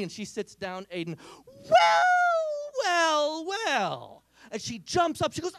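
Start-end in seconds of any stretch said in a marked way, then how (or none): 1.5-4.2 first well swooping very high and loud, falling after; gloating well well well. Retold or imitated. Nephew gloating as sister sits in it, sing-song gloat. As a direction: drag out the triumph.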